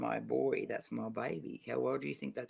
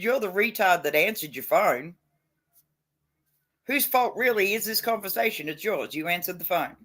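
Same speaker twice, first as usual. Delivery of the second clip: retold telling-off; 6 semitones higher, 6 dB louder than usual. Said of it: "And I said".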